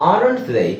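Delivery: ordinary talk, nothing marked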